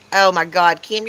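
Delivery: ordinary delivery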